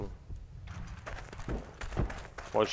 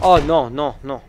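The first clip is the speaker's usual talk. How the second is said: shouting; 12 dB louder, slower than usual. Real, not acted. Getting killed in game.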